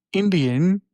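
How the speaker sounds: put-on a playful Indian accent, just the word Indian; mock Indian accent. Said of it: "saying the name of the accent with emphasis".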